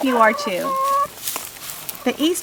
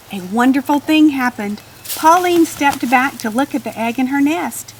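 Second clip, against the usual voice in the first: usual speaking voice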